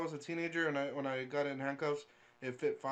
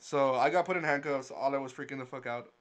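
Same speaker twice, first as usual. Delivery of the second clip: ordinary talk, nothing marked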